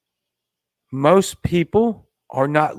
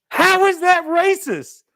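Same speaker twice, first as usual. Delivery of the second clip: mock incredulous. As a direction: ask it like the idea is absurd, slow and shrill-high. Mock segment title question.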